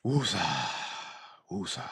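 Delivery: self-soothing calm-down mantra. Real, not acted. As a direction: exhale the word to settle yourself. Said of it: "So let me chill".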